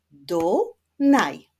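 "Dunaj" in two loud, separated syllables; split into syllables. Shown as a demonstration. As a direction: clap out "Dunaj" beat by beat. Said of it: "Wyklaszczmy sylaby w nazwie".

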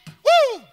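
high, loud preacher's whoop; exultant whoop. Real, not acted. Preacher whooping at his own sticker idea, high and loud. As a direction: let out a joyful woo.

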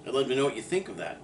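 ordinary talk

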